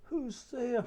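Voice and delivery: put-on high old granny calling out; a lightly voiced grandmother asking who knocks; Grandmother's voice. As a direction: call out like a frail old grandmother. Grandmother answers the door.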